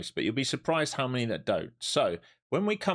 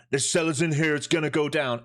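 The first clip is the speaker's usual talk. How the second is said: higher and louder, mocking a cocky trader; mock know-it-all trader; 7 semitones higher, 4 dB louder than usual. Streamer mocking a rival trader's confident call, hammed-up. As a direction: parody the guru's confident call. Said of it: "if you traded with Mister Perm".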